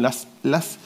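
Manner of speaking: ordinary delivery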